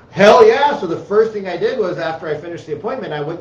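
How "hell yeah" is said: loud, high burst of eager yes; excited shout. Retold or imitated. Young salesman hearing about a rich grandma, loud eager shout. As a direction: shout it like you can't wait. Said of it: "And I was like".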